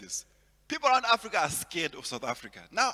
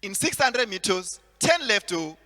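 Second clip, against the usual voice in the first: put-on Nigerian accent; 7 dB louder than usual. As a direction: give directions like a Nigerian GPS. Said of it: "there's a Nigerian accent there".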